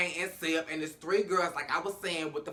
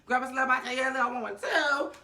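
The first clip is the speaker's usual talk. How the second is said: much higher, laughing, giddy; a high, laughing, breathless party girl voice; squealing excited girl; 9 semitones higher than usual. Retold or imitated. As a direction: squeal the invitation like a tipsy friend. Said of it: "one of the girls was like".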